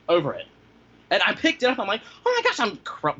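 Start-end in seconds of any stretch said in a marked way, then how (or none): 2.2-2.6 high-pitched mock wail; hammed-up panicked cry, much higher; retold bawling. Retelling finding the dog seizing, mock bawling. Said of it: "bawling my head off".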